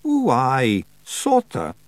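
high Scots pixie voice, slow and hopeful; a wee Scots pixie begging to keep a pet; Feagle voice. Acted. Feagle voice held.

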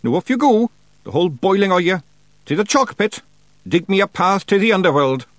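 high, loud Scots commands; Feegle chieftain ordering. A narrator's reading. Rob dispatching his clan to the chalk pit, high Scots commands.

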